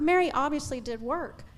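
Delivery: ordinary talk, nothing marked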